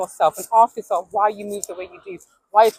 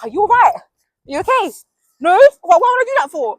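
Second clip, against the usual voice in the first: incredulous loud; 8 semitones higher, 9 dB louder than usual. Retold or imitated. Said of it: "I'm like".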